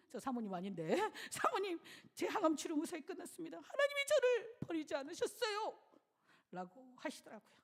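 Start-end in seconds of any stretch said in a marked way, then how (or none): 1.3-5.8 joyful report. Retold or imitated. Deacon's joyful report retold.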